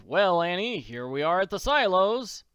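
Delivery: a big-hearted millionaire taking charge; hearty Daddy Warbucks. Acted. Warbucks tells Annie he'll soon fix her hunger, loud and hearty.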